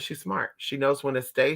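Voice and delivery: ordinary talk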